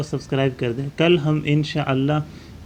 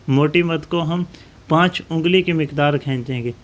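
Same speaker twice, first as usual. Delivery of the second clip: ordinary talk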